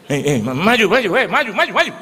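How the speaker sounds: loud, high-pitched repeated shouts; urgent urging. Retold or imitated. Crowd pushing him to step forward, loud urgent shouting.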